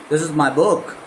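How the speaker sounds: book lifted at the end; stress on book. Shown as a demonstration. Teacher drilling stress on book. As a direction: rise on book. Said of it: "इस तरह से आपको करना पड़ता है".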